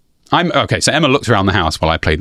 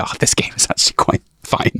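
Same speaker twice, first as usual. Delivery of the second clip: whisper; 4 dB quieter than usual. Retold or imitated. Sheepish admission of changed opinion.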